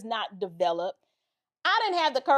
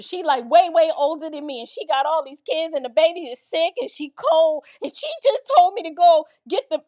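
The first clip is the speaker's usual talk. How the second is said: higher, mounting plea; tearful pleading, retold; 4 semitones higher, 5 dB louder than usual. Crying teen explaining herself to officer, pleading. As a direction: pile up excuses with rising urgency, imitating distress. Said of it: "So I start crying".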